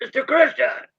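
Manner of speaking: high, loud captain's bellow; Charles Laughton impression. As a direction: call him out like a ship's captain. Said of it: "That was Charles Lawton".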